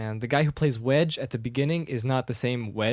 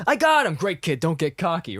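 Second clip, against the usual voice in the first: excited film quote; 5 semitones higher, 4 dB louder than usual. Retold or imitated. Pilot to Luke in the gunfight, excited mock shout. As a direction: crow in triumph, then warn the kid.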